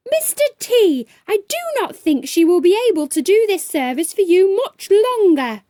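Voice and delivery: loud, fast furious outburst; angry high shout. A narrator's reading. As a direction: fire back in fury. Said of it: "she screamed".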